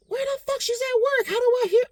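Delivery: high, startled disbelief; retold shocked confusion. Talking to himself, stunned to hear his girlfriend through home cameras, high startled disbelief. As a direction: replay the moment you hear a voice from nowhere.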